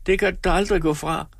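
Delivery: a hint of weeping woman; crying. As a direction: insist through mock tears. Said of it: "nu græd Tripstine".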